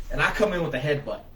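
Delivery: plain reading voice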